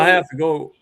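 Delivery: mild put-on Southern accent. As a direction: say it like a Southern relative, half-joking. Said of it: "now I sound Southern".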